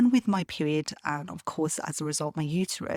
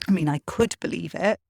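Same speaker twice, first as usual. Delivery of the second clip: ordinary talk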